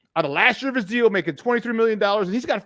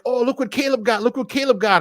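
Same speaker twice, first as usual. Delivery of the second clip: imitating giddy fans, pitched up; mock giddy; 6 semitones higher than usual. Host mimicking giddy radio guys, mock giddy. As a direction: gush it in a higher mocking voice. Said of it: "they were all giddy".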